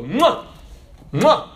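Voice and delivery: two loud, high smacking cheek kisses; loud kiss sounds. Retold or imitated. Soldier greets the preacher with cheek kisses, loud smacking mwahs.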